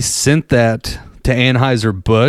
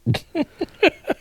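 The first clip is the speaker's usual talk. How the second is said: high squawky chicken clucks; chicken clucking; 6 dB quieter than usual. Retold or imitated. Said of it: "essentially cluck like a chicken".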